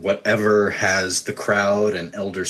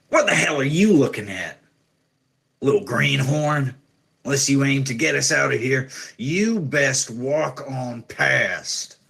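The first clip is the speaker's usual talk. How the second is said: higher-pitched, challenging rough-man voice; gruff prisoner; 6 semitones higher than usual. Acted. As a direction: snarl a threat like a rough jailbird. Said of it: "grizzled and rather rough-looking men".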